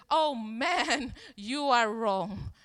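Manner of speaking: loud, drawn-out mock protest; protesting denial. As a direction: act out an insistent denial, loud and slow. Peter's acted denial to accuser.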